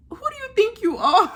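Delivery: half-laughing jab at an overconfident guy; laughing disbelief. Real, not acted. Vlogger to Davide, laughing in disbelief. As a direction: laugh through the question.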